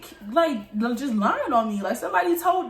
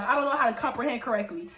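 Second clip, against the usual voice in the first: ordinary talk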